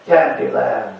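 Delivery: ordinary talk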